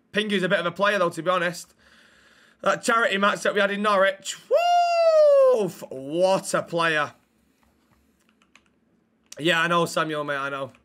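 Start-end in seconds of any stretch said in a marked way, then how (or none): 4.5-5.7 very high, loud "woo"; excited whoop. Real, not acted. Streamer recalls Pingu's charity match, excited whoop. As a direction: whoop sky-high in admiration.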